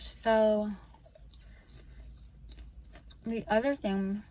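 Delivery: chewing while talking; mouth full. Real, not acted. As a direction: speak through a mouthful. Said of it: "try not to talk with my mouth full".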